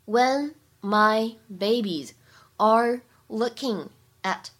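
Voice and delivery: very slow, each word spaced with pauses; slow teaching read, one word at a time; slow word-by-word drill. Shown as a demonstration. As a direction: break it down word by word for learners. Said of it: "need a可以做连读".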